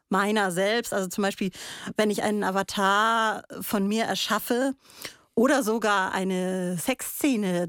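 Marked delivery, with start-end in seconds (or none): none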